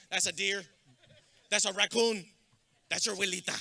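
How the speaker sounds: loud mock tour-guide callouts; calling out. Joking about Mathis zoo to the graduates, loud callouts. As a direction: point out each one like a tour guide.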